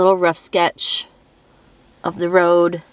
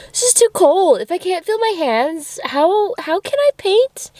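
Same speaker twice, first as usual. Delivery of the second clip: squeaky complaining, much higher; high-pitched whine; 12 semitones higher than usual. Real, not acted. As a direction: whine it up high, half laughing. Freezing hands, voice rising in complaint.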